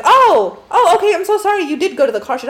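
high, flustered mock apology. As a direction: squeak out a sheepish sorry. Guy caught out, apologising.

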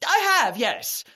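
high, anxious to please; nervous eagerness. Acted. Husband presenting the food to his wife, high and anxious. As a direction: rush to please her.

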